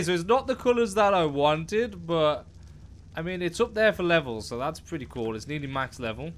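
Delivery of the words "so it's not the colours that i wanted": ordinary talk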